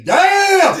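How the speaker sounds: loud, high, drawn-out "Damn!"; admiring catcall. Acted. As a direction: holler it long in mock admiration. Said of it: "when you look and say".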